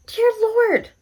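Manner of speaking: high-pitched exasperated dear Lord; exasperated squeal. Real, not acted. Vlogger scolding herself for the wrong month, high squeal. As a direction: squeal it in mock despair. Repeats exasperated, higher still.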